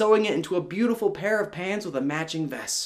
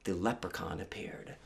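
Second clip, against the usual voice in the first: ordinary delivery, nothing marked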